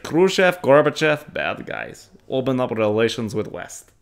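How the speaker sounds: put-on museum guide with a Russian accent. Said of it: "My Russian accent is not terrible".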